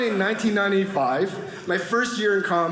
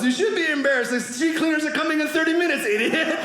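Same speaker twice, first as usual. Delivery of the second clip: mock scolding; 8 semitones higher than usual. Friend scolds him instead of helping.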